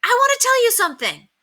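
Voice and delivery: high, loud call. A narrator's reading. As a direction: keep calling from the window. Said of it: "called Henry".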